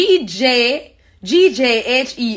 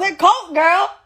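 higher-pitched, insistent comeback; exasperated correction; 7 semitones higher than usual. Real, not acted. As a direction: correct your friend, exasperated. Exasperated at being misheard.

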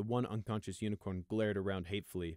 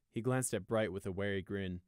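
plain reading voice

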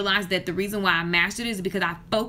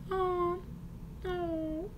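touched; 9 semitones higher, 9 dB quieter than usual. Real, not acted. Touched by client's text.

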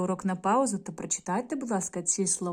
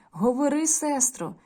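calling brother; 6 semitones higher, 4 dB louder, slower than usual. A narrator's reading. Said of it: "гукає".

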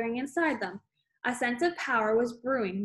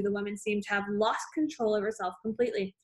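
ordinary delivery

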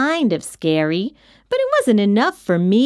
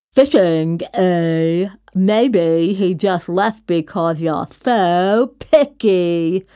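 monster retort; 4 semitones lower, slower than usual. Acted. Monster Mac retorts to the kid.

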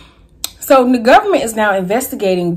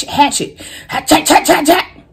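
each hatchet higher and louder; building emphasis; 9 semitones higher, 3 dB louder than usual. Real, not acted. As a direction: climb higher on every hatchet. Rising repeated word.

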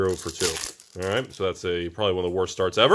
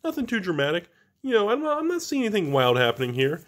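higher, lightly put-on nonchalant voice; casual self-quote; 10 semitones higher, faster than usual. Card opener mimicking himself before the big pull, lightly mock-casual. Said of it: "And I was like".

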